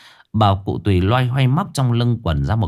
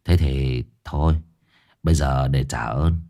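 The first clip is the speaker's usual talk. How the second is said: lower, calm big-brother voice; Dương Đông, low and amused; 8 semitones lower, 3 dB quieter than usual. Acted. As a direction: grant the favour with easy authority. Dương Đông nói với vong.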